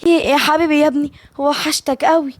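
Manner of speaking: high, delighted mother's voice; joyful. A narrator's reading. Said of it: "نادية بفرحة".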